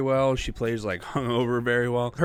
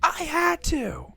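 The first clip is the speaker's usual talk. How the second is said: put-on much higher, slow little-kid voice; imitating little girl; much slower than usual. The girl answering Supergirl, slow high little-girl mock. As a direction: answer like a sulky child. Acting out the girl's reply.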